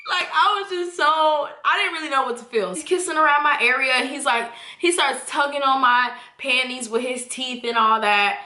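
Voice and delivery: ordinary talk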